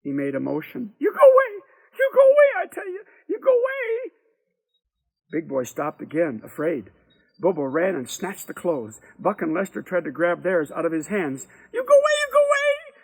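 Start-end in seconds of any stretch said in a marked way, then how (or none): 1.0-4.1 very high, shrieked warning; terrified screaming. A narrator's reading. Frightened woman driving off naked boys, high hysterical scream. 11.7-12.9 very high, shrieked warning; terrified screaming. A narrator's reading. Frightened woman driving off naked boys, high hysterical scream.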